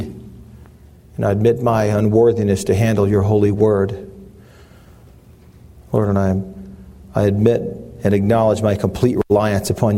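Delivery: low, earnest prayer voice. Acted. Pastor opening the sermon with prayer, humble prayer. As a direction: pray it humbly, unhurried.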